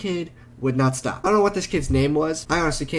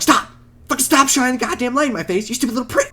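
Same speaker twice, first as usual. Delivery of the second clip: high, rapid angry rant; angry retold outburst; 9 semitones higher, faster than usual. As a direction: act out an exasperated telling-off, pitched up. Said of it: "I didn't say it like that".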